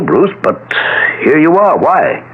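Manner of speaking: puzzled. Acted. Doctor puzzled by son's arrival.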